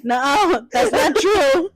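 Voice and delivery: high, giggly nuh-uh; laughing kid protest. Retold or imitated. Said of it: "I'm like".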